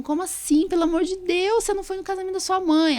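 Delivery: high, indignant disbelief; a shocked doctor's "Como assim?"; indignação incrédula. Retold or imitated. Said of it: "Eu falei assim". (incredulidade indignada, voz bem mais aguda)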